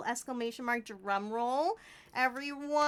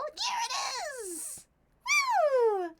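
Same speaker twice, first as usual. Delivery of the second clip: excited cheer; 5 dB louder, much slower than usual. Real, not acted. Cheering the cat reveal.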